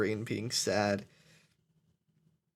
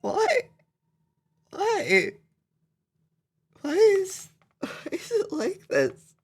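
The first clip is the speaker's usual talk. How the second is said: crying; 6 dB louder, slower than usual. Real, not acted. Breaking down, pleading why.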